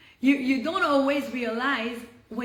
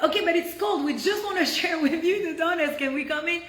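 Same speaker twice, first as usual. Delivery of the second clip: pleading; 6 semitones higher, 4 dB louder than usual. Retold or imitated. Retold pleading at the door.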